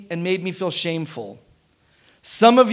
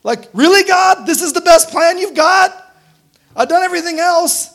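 higher, begging voice rising into disbelief; pleading Jesus; 6 semitones higher, 5 dB louder than usual. Retold or imitated. Jesus in the garden begging God, higher and pleading. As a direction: plead with God, then ask in raised disbelief. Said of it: "The Jesus in the garden who's like".

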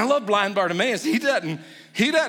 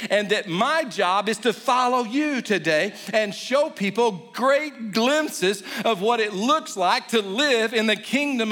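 rising, fervent prayer voice, pitch climbing; modelled prayer; 4 semitones higher than usual. Shown as a demonstration. Pastor modelling a prayer for the congregation, fervent.

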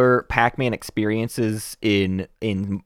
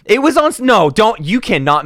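sharp raised voice; loud sharp voice; 11 semitones higher, 5 dB louder than usual. Real, not acted. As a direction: snap back loudly. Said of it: "[name], stop yelling at me".

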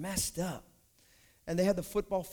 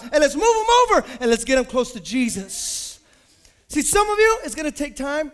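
loud, high, driving sermon climax; full-force preaching; 6 semitones higher, 10 dB louder than usual. Acted. Pastor rallying his church to revival, full-force preaching. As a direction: preach it hard to the crowd.

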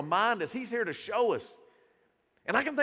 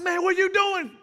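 much higher, louder indignant outburst; mad; 10 semitones higher, 6 dB louder than usual. Retold or imitated. Preacher imagining confronting Peter, loud indignant outburst. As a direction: snap at him like you're fed up. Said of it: "me, I'd be mad".

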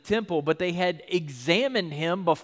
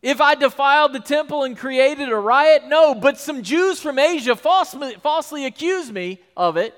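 higher, louder, heated; Paul exasperated and worked up; 7 semitones higher, 7 dB louder than usual. Retold or imitated. Paul defending himself, exasperated and loud. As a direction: erupt in indignation. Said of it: "how did we get here".

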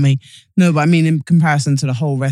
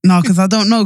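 ordinary talk

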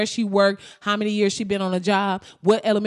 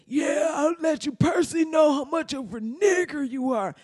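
hammed-up rage in a raised pitch; 6 semitones higher than usual. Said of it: "he gets mad".

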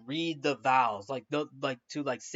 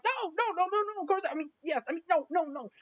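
very high, panicky babble; flustered Victor; 13 semitones higher, faster than usual. Retold or imitated. Groom struggling with his vows, high flustered panic. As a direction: fumble the answer in a squeaky high pitch. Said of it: "And Victor's like".

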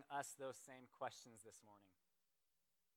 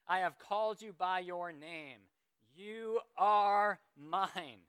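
raised, emphatic preaching voice; forceful scripture declaration; 4 semitones higher, 6 dB louder than usual. Acted. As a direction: proclaim each promise loud and high, easing at the end. Reads Isaiah passage with force.

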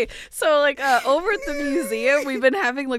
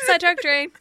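normal talking voice

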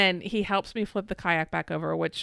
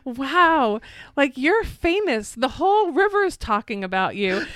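mock-amazed teasing, higher and louder; playing it up; 6 semitones higher, 5 dB louder than usual. [name] teasing wife about the flip, mock-amazed and higher. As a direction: ham up the gossip to needle her. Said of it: "you're like playing it up".

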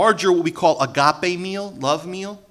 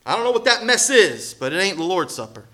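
higher, louder scolding Paul; strong rebuke; 6 semitones higher, 7 dB louder than usual. Retold or imitated. Preacher voices Paul scolding the church, loud and stern. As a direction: give it to them hard, scolding. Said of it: "Apostle Paul has to say".

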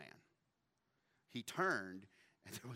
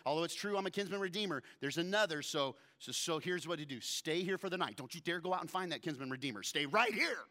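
higher, louder and fast, pressing her to stay; urgent excited Boaz; 6 semitones higher, 7 dB louder, faster than usual. Retold or imitated. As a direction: talk her into staying, rushing. Boaz urgently keeping Ruth.

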